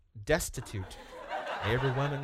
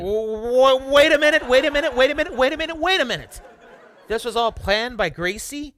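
high, loud, fast wait a minute; sputtering alarm, louder and higher; flustered; 11 semitones higher, 10 dB louder than usual. Real, not acted. [name] alarmed at the plan.

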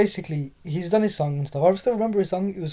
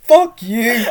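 loud, higher laughing retort; exasperated swearing; 4 semitones higher, 8 dB louder than usual. Real, not acted. Teased storyteller to laughing friend, loud exasperated retort.

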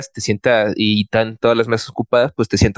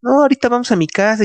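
pushy invitation; 11 semitones higher, faster than usual. Retold or imitated. Date pushing him home.

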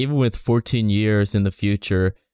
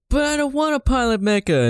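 whiny Shinji. Retold or imitated. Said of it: "Shinji's like".

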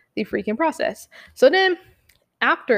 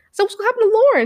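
louder and higher, a sharp demanding question; retold outburst at the office, louder and higher; indignant demand; 13 semitones higher, 7 dB louder, much faster than usual. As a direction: push the question out hard, outraged. Demanding answer about Lauren.

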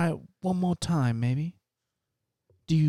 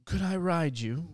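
ordinary delivery

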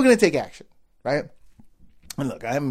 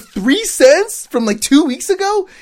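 slow, very high disbelieving question; incredulous; 14 semitones higher, 8 dB louder, slower than usual. Retold or imitated. Himself in the car staring at the receipt, slow high disbelief. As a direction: stretch it out like you can't believe it.

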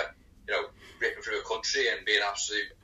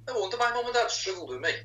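higher, exasperated aside to himself; exasperated realisation; 12 semitones higher than usual. Retold or imitated. Dad watching his toddler, high and exasperated. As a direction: throw it up high in weary disbelief. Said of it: "I look at her and go".

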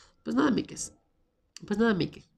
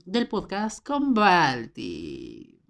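slow, drawn out; much slower than usual. Shown as a demonstration. Host trying the show name, slow and odd. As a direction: stretch the show name out slowly, oddly. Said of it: "eso sonó muy raro".

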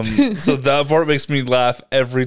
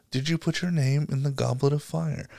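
soft, calm question; Dumbledore; 8 dB quieter than usual. Retold or imitated. Reading the book's version to friends, calm question. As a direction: ask it gently and quietly, unhurried. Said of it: "Dumbledore calmly asks Harry".

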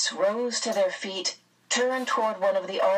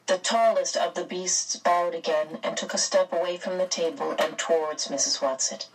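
ordinary delivery

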